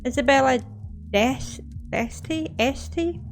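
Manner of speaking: "Deste?" tried with a rising, unsure tone; "Este?" guessed high and questioning; unsure name reading. Real, not acted. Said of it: "it's my lisp".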